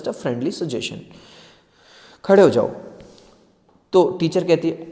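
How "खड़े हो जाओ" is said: loud sharp order; commanding. Shown as a demonstration. Teacher's tone shown wrong, as a loud command. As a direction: bark it as a command. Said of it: "ऐसे नहीं कही".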